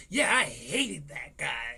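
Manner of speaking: slow, low, earnest agreement; slowly voiced eager agreeing stranger; mock bigot. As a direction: drawl it like a smug bystander. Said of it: "and they're like".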